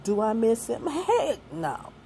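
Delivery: ordinary talk, nothing marked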